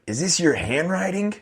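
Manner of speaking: high-pitched mocking question. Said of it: "Mexican dude who's".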